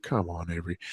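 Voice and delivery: dad gently soothing his crying son. Retold or imitated. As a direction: soften right down to comfort a crying child. Comforting crying toddler.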